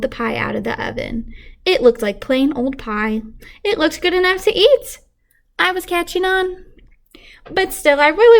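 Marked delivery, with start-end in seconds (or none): none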